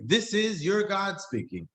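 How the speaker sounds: lightly hammed-up heavenly announcer; grand announcement. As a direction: proclaim like a pompous announcer. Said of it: "He did not get up and say".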